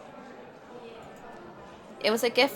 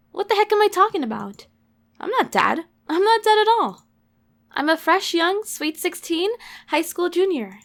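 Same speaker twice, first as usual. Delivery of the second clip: high, loud protest; indignant disbelief; 6 semitones higher, 8 dB louder than usual. Acted. Sixteen-year-old heroine insisting she isn't dead, indignant. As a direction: protest it loudly, pitch jumping up.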